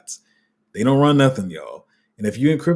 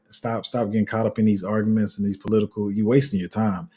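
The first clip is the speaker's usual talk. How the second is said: ordinary talk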